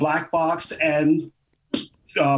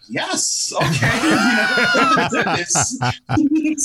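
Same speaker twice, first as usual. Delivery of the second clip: excited yes; 8 semitones higher, 3 dB louder, much slower than usual. Retold or imitated. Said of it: "I was like".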